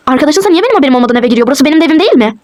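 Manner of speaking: ordinary delivery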